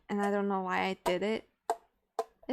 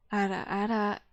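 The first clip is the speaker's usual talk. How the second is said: "ara, ara" kept down at a low pitch; low-register "ara, ara"; as low as possible. Shown as a demonstration. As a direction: say "ara, ara" at the bottom of your range. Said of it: "I can't go any lower".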